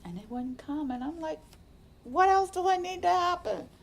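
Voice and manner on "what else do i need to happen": much higher and louder, a desperate cry; exasperated outcry. Retold or imitated. Frustrated patient when tears won't come, loud exasperated cry. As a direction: throw the question up at the sky.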